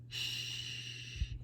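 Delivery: long hushed "shh"; soft, drawn-out shush, as if tiptoeing; sneaky shushing sound. Acted. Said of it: "who is tippy-toeing behind her, going".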